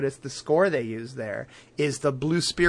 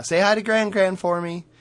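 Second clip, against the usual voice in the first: put-on lightly raised, sweet girlish line; a hint of a chirpy Katara; mildly higher cheerful goodbye; sweet sister voice; 5 semitones higher than usual. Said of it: "Katara gives a little".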